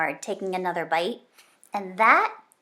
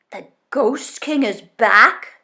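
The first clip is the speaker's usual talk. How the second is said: dread; 4 semitones higher, 9 dB louder, slower than usual. Acted. Dread as lights go out.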